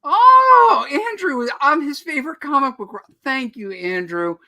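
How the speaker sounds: high, loud burst of delight; real thrilled squeal, easing as it goes; delighted gushing. Real, not acted. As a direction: gush over a fan's compliment. Delighted by a fan's compliment.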